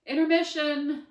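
loud high call of Intermission; crying out. Acted. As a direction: announce it at full volume. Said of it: "Miss Dougal cried".

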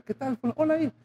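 high, sugary put-on hello; mock sweet greeting. Pastor mimics a stepparent's forced hello, high and sugary. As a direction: greet the stepchild with fake sweetness. Fake-friendly greeting to stepchild.

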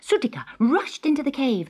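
ordinary delivery, nothing marked

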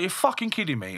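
high-pitched, indignant disbelief; retold disbelief. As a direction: react incredulously to maddening news. Woken up, raging at the news.

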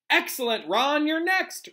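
high, rallying shout; calling out. A narrator's reading. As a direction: call the next pupil up, stretching the end. Lupin calls next pupil forward.

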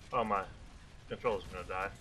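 normal talking voice

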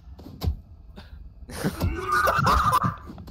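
high, breathless laughing fit; helpless laughter. Real, not acted. Player cracks up at the giant by Tyler, breathless laughter. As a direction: crack up until the voice gives out.

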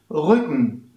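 "Rücken" with a short Ü, almost like Ö; short Ü model. Shown as a demonstration. Teacher modelling the short Ü in "Rücken". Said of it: "fast wie Ö".

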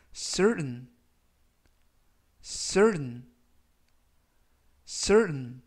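certain with the T almost silent; model pronunciation. Shown as a demonstration. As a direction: say certain as sern, the T swallowed. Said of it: "이라고 발음하시면 되겠고요".

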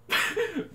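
high, soft burst of laughter; helpless high giggle. Real, not acted. Laughing at bad performance.

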